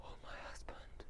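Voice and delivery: very soft unvoiced whisper; ghostly wife. Wife whispering to her husband like a ghost. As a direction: breathe it like a ghost.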